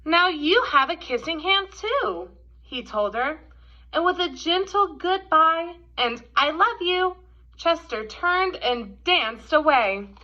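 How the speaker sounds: gentle, expressive storybook read-aloud; reading to children. A narrator's reading. Teacher reading The Kissing Hand to kids, gentle storytelling. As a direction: read it like a picture book at story time.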